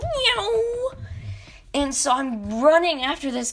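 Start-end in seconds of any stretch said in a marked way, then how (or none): 0.0-0.9 high mock meow, far above the normal voice; playful imitated meow; cat meow. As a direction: squeak it out like a fleeing cat.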